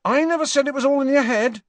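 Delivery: high, loud, fast retort; frowning retort. Acted. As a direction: snap back defensively. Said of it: "frowning at Harry".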